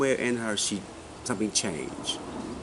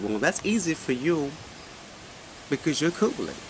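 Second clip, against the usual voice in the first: imitated young man's Caribbean accent, pitched higher; young Caribbean man, mildly imitated; 5 semitones higher, 4 dB quieter than usual. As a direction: retell it in a lightly raised Caribbean accent. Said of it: "he said it in a Caribbean accent".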